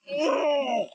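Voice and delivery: very high, wailing "oh". A narrator's reading. Scruffy being pulled apart, high wail. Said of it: "wailed Scruffy".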